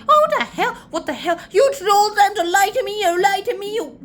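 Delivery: very high, loud mock tantrum; hammed-up rage. Girl confronting the uncle who lied, hammed-up rage. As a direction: explode in overacted outrage.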